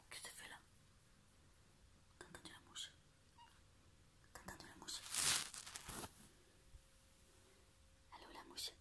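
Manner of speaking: soft whispering to a sleepy cat; ASMR whisper. Real, not acted. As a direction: hush it, barely a breath. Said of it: "Je fais de la A S M R".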